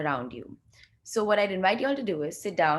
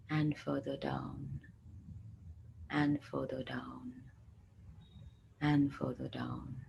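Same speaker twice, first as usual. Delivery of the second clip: guided meditation; 3 semitones lower, 4 dB quieter than usual. Acted. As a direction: let each line fall softly into silence. Guides barrier release slowly.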